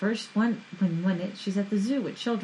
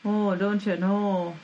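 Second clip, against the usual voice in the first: slow, drawn-out Minnesota "Oh, don't you know?"; Minnesota accent; slower than usual. Acted. Co-host showing off a Minnesota accent, slow and broad. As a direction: lean hard into a Minnesota accent. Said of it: "you're really in Minnesota there".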